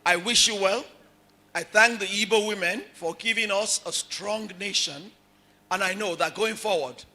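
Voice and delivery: usual speaking voice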